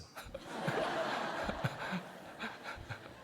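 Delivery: silent wheezing chuckle; a soft airy self-mocking laugh; breathy laugh. Real, not acted. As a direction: laugh under the breath at yourself. Laughs at own confession.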